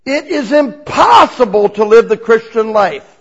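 loud preaching proclamation; preacher booming to a crowd. Shown as a demonstration. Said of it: "he would say".

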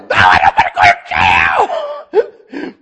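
loud, slow, teary shout; pleading shout. Retold or imitated. Convert shouting back at the cop. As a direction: beg not to be jailed.

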